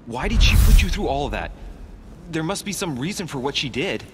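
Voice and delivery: high, urgent pleading for an explanation; distraught demand. Acted. Son demanding why his mother tortured her, distraught.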